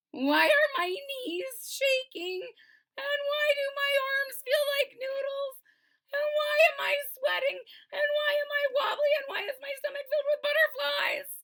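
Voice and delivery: high, shrill-edged panic building; panicky. Acted. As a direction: voice climbing with rising fear. Scared at top of slide.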